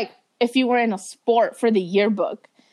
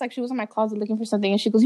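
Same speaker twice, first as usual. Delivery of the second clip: ordinary talk, nothing marked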